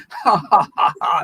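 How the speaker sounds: put-on giggle; silly pretend laugh. Shown as a demonstration. Leader shows the class it needn't be real, pretend laugh. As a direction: laugh it out on purpose, silly and loose. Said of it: "a silly laugh or a pretend laugh".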